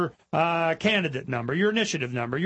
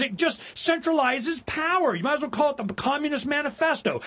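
Patrick Henry warning; 8 semitones higher than usual. Retold or imitated. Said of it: "He goes".

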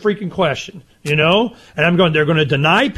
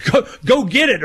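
words through laughter, higher; laughing; 8 semitones higher than usual. Real, not acted. Still amused by the mix-up, laughing.

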